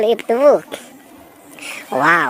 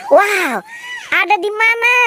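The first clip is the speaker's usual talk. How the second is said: excited shout; 11 semitones higher than usual. Real, not acted. Shouting out search.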